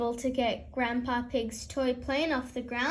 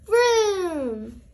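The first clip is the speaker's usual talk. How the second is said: loud engine whoosh; vroom; 3 semitones higher, 4 dB louder than usual. A narrator's reading. The plane flies over Granny: loud vroom. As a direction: plane zooms past. Toy plane sound effect.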